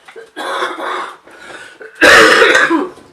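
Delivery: a loud real cough, rough and unvoiced; coughing fit. Real, not acted. Crafter demonstrating cards coughs from her chest infection, loud cough. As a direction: cough hard, mid-sentence.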